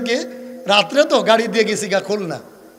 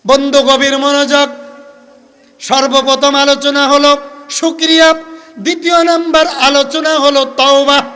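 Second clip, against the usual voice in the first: high, sustained preacher's sing-song; measured chanting to a congregation; intoned sermon teaching; 7 semitones higher, 7 dB louder than usual. Acted. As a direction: announce each point on a held note.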